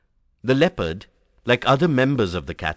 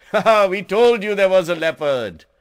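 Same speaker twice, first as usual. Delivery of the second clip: high, loud, gleeful crowing; good-humored shout; 10 semitones higher, 6 dB louder than usual. A narrator's reading. Hunters showing off the dead leopard, shouting in great good humor. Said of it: "they shouted in great good humor".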